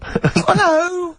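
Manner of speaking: high campy cry of surprise; comic character. Pretend. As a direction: squeal it out as a silly character. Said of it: "he's a new character to me".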